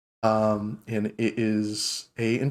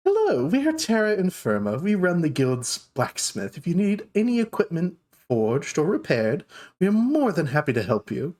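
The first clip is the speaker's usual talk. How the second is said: higher, lilting customer greeting; welcoming dwarf shopkeeper; 6 semitones higher than usual. Acted. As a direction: welcome customers in a high, helpful voice.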